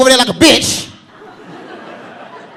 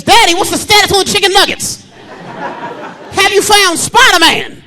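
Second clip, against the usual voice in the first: lightly voiced little kid asking daddy; 7 semitones higher than usual. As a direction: ask like an eager small boy. Comedian voicing his young son.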